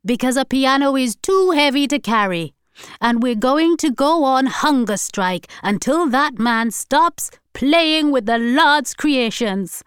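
high, loud zealous grandmother; excited. A narrator's reading. Grandmother proclaiming her hunger strike plan, excited. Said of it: "added Hortense excitedly".

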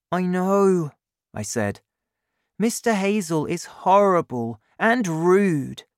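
lightly voiced eager boy agreeing; a hint of a young boy, indignant; young Danny.

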